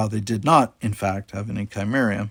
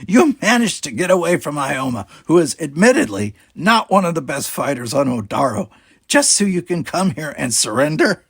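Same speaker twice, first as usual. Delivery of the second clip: higher, louder scornful captain; mocking pirate captain; 6 semitones higher, 5 dB louder than usual. A narrator's reading. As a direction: laugh off their offer with scorn. Talis mocking the surrender.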